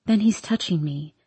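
plain reading voice

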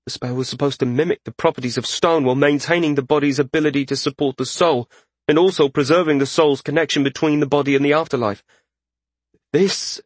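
high, quick, absorbed spellcaster; earnest journeyman mage. Acted. Narrator voices the journeyman mage.

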